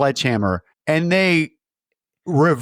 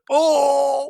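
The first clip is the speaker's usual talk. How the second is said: pained cry; 11 semitones higher than usual. Retold or imitated. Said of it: "you hear".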